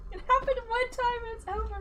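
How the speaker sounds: laughing. Real, not acted. Laughing about the lucky throw.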